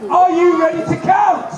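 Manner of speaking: high, loud, slow hype shout; big rallying shout. Acted. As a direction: bellow it to rouse the crowd. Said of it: "Come on, that was rubbish".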